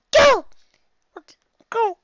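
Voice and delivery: put-on very high, loud kid shout; child's yell. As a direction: bellow it like an impatient little child. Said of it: "I yell again".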